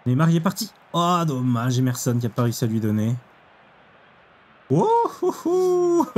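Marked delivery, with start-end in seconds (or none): 4.7-6.1 high rising oh-oh-oh with a laugh breaking in; yelping with excitement at the screen; excited whoops. Real, not acted. Gamer watching his striker break through, excited yelps. Big chance in the match.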